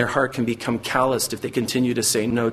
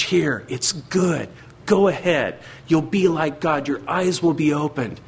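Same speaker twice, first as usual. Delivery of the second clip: a lightly voiced serpent, coaxing and higher; tempting devil; 4 semitones higher, slower than usual. Preacher voicing Satan tempting Eve, mild coaxing lure. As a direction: sweet-talk them like the tempter, slow at first. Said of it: "the result of Satan's package".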